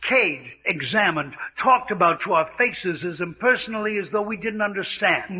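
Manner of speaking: ordinary talk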